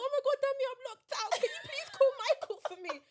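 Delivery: high, loud frantic begging; imitating a frantic friend; panicked plea. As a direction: plead in a rush, locked out and desperate.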